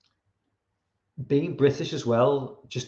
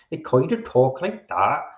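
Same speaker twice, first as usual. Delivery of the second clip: mock rural English West Country accent; 3 dB louder than usual. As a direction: imitate country folk from the West Country or East Anglia. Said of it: "East Anglia, which is another place".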